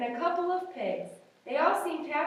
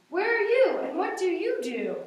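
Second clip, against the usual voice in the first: rude giraffe question; 7 semitones higher, 6 dB louder than usual. Acted. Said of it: "but not very nicely".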